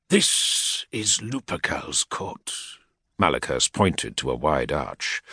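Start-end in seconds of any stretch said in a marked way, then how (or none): 0.1-2.5 a hint of a cold, slow menacing commander; lightly voiced warlord's aide, measured threat; menacing equerry. Equerry rebuking a petitioner, lightly voiced menace. Malaghurst speaks.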